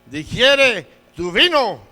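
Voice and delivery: loud, slow reprimand; emphatic acted scolding, word by word; stern rebuke. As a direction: order it slowly, like a scolding priest. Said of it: "le dijo".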